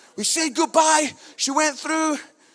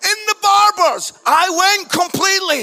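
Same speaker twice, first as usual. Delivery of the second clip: much higher, louder hammed-up wail; mock breakdown wail; 9 semitones higher, 7 dB louder than usual. Father breaking down in the barbers, hammed-up loud wail. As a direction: burst out as if losing it completely.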